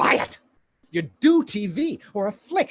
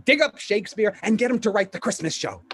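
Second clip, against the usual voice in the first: higher, pushy pile-up of promises; excited sales patter; 5 semitones higher than usual. Acted. Agent sells actor on syndication riches, excited sales patter. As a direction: build the money pitch with rising enthusiasm.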